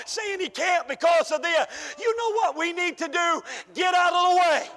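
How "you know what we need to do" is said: ordinary delivery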